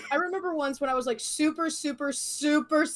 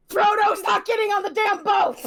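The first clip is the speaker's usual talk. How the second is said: loud, raised, laughing holler; mock exasperated shout; 5 semitones higher, 6 dB louder than usual. Waking up mid-movie in the retelling, mock exasperated shout. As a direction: shout it as a running joke. Echoes the dad's exasperated yell.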